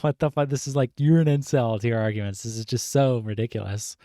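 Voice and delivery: ordinary delivery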